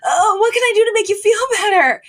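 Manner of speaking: high-pitched mock panic; frantic apology. Daughter apologizing to her hysterical mother, frantic. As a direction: plead fast, way up in pitch.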